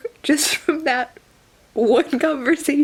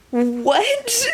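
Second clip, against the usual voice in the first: disbelieving what; 10 semitones higher than usual. Real, not acted. Disbelief at the book.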